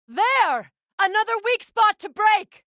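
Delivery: high, loud alarm calls; battle warnings shouted. Acted. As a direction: warn your ally over the noise of the fight. Combat callouts.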